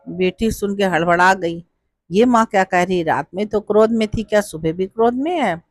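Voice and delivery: usual speaking voice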